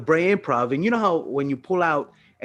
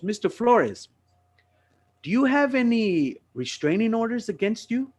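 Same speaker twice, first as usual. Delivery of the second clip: higher-pitched official questioning; imitated police officer; 8 semitones higher than usual. Officer returns to question the driver, imitated official tone. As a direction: put on a formal cop asking questions.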